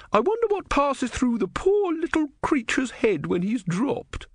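high, pitying pious woman. A narrator's reading. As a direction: coo with pity for the creature. Woman character voice.

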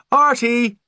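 high, loud sing-out of a name; mother calling out. A narrator's reading. Mother calling her son from the car, high calling voice. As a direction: call brightly up through the house. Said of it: "she called".

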